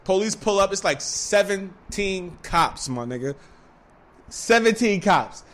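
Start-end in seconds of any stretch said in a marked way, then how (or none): none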